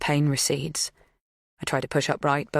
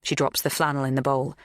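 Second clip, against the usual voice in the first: ordinary delivery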